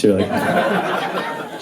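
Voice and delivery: ordinary talk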